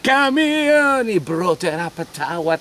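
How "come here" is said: soldier's bark. Acted. Soldier dragging a woman.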